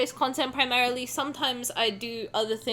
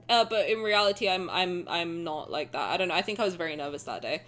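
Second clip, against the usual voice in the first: ordinary talk, nothing marked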